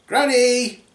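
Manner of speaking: high call at the door. Acted. Calling at granny's door.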